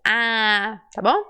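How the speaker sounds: ordinary talk, nothing marked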